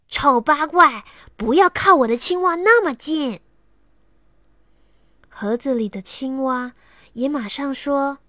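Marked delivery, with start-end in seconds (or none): 0.1-3.4 put-on loud, higher, nasty child's insult; fierce snap. Xiaowei snarls at Lala to keep off his frog, loud and fierce. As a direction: bark it at her to get away. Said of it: "很凶地对拉拉说".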